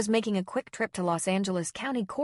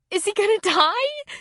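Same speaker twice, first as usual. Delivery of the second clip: desperate; 14 semitones higher, 8 dB louder than usual. A narrator's reading.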